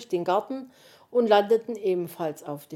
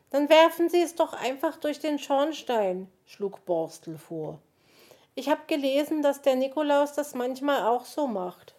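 high-pitched, eager little character voice; Borstel; 7 semitones higher, 3 dB louder than usual. A narrator's reading. Borstel suggesting the chimney to the others, eager high voice. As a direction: pipe up high with a helpful suggestion.

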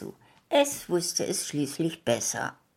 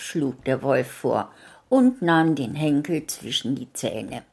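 ordinary delivery

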